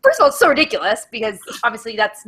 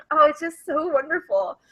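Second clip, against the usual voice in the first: ordinary talk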